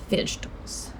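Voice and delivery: hushed whisper, barely voiced; very soft, voiceless aside; whispered dread. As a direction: whisper it like a dirty word. Repeats the dreaded word after sigh.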